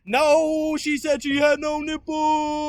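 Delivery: very high, drawn-out holler; loud, stretched comic yell; hollered outburst. Pretend. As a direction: belt it out slowly at full volume. Sudden yelled outburst.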